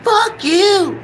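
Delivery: shrill high parrot squawk, way up in pitch; squawking parrot swearing at its owner. Retold or imitated. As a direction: screech it like a cursing parrot. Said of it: "He just likes saying his name".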